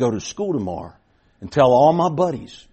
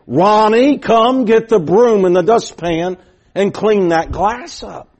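loud, slow, high calling out of an order; firm call; 5 semitones higher, 5 dB louder, slower than usual. Retold or imitated. Neighbor lady calling the boy back to the porch, slow loud order. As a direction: call him back firmly to clean up.

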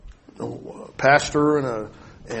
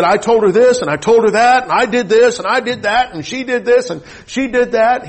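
high, loud tattling on the wife; hammed-up, high-pitched self-justifying rant; indignant complaining; 9 semitones higher, 6 dB louder than usual. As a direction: reel off grievances in a mock whine of protest. Acted complaint to his dad.

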